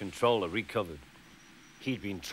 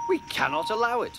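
quick, high-pitched protest; indignant; 11 semitones higher, faster than usual. A narrator's reading. Offended engines agree to act against Duck, indignant.

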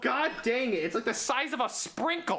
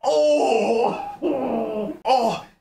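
pained laughing cries; 2 semitones higher, 8 dB louder than usual. Real, not acted. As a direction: wail from the throbbing toe. Pain after stubbing toe.

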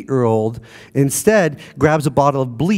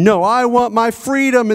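put-on child screaming; 9 semitones higher than usual. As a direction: cry out like a kid demanding freedom. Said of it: "they start screaming".

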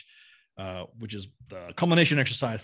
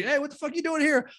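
much higher, delighted buddies calling out; excited greeting; 14 semitones higher than usual. Retold or imitated. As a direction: imitate friends surprised to see him. Old buddies greeting him, retold.

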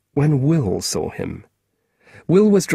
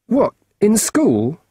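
put-on startled boy; 10 semitones higher, 4 dB louder, slower than usual. Boy caught out, surprised.

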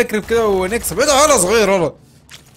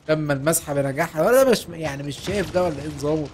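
ordinary talk, nothing marked

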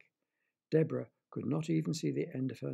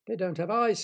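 snapped; 5 semitones higher, 7 dB louder than usual. Acted. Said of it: "snapped Deborah".

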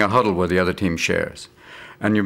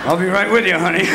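higher, louder, laughing as he says it; laughing through it; 10 semitones higher, 5 dB louder than usual. Retold or imitated. Phone sex caller laughing to honey. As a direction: giggle into the line.

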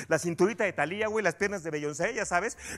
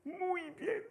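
stern parent voice; 7 semitones higher, 10 dB quieter than usual. Acted. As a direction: warn with tightly held-back anger. Parent sternly lectures before exploding.

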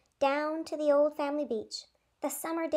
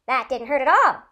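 louder, cheery surprise; happy exclamation; 9 dB louder than usual. A narrator's reading. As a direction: bounce it out gleefully. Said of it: "Leno exclaimed happily".

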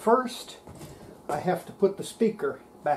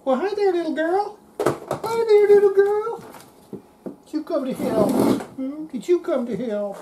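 high-pitched sing-song pet talk; 12 semitones higher, 5 dB louder than usual. Real, not acted. Greeting the kitty in baby talk.

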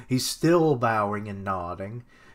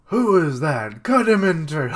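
loud, raised acted shout; shouted command; 5 semitones higher, 9 dB louder than usual. Enemy orders the stranger cut down, shouted command. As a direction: sound the alarm and order an attack.

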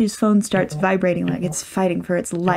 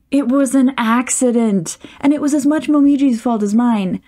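louder, higher shot back; indignant, raised-voice comeback; defensive retort; 5 semitones higher, 4 dB louder than usual. Acted. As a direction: snap back in self-defense. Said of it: "Yuichi shoots back".

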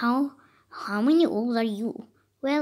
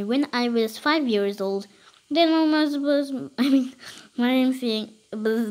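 ordinary talk, nothing marked

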